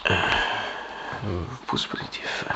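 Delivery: slow breathy whispered aside; a slow, secretive whispered aside. Real, not acted. Aside about a thumbs up.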